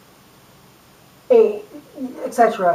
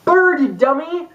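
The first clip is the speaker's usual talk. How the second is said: put-on higher, slower, indignant kid voices; mock furious kids; 6 semitones higher than usual. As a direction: scold like an outraged preschooler. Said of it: "They were furious".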